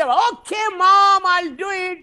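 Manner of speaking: slow, drawn-out dismissive shout at mother; rude loud reply to mom. Retold or imitated. Son shouting back rudely at his standing mother. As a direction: brush mom off loudly and rudely. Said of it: "ماں سے بڑا تیز بات کر رہا ہوں، بڑی آواز سے".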